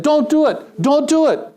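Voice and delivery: retold yell. Preacher retelling yelling up at a man on the bridge, high and loud. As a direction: call out urgently to the jumper. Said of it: "And so I yelled at him".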